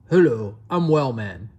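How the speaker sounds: low, firm voice for a strange creature; lightly voiced low creature; odd creature character. A narrator's reading. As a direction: introduce yourself as a low-voiced oddball. Strange thing introduces itself.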